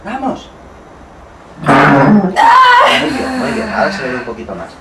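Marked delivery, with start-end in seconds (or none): 1.6-3.7 high loud cheer; loud praise. Real, not acted. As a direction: cheer her success. Praise after push.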